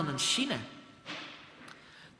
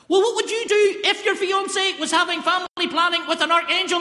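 louder, higher, pleading exasperation; exasperated outburst; 12 semitones higher, 6 dB louder than usual. Acted. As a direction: blurt it out in desperation. Exasperated defence to mother.

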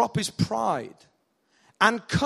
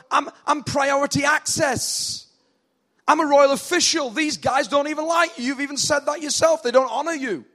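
higher, louder incredulous protest; indignant pleading; 5 semitones higher, 3 dB louder than usual. Acted. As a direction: argue and plead with raised pitch. Official protests and pleads with Jesus.

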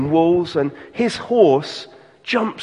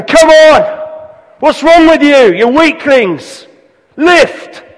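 loud, high barking shout at the men; bellowing army corporal; 9 semitones higher, 10 dB louder, slower than usual. Retold or imitated. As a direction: yell at them like a drill corporal.

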